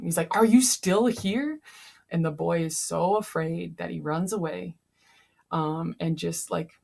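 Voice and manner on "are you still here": sudden loud yell, higher and louder. Retold or imitated. Jean Valjean yelling at the boy to make him leave. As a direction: bark it at the kid to scare him off. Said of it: "basically yells at him".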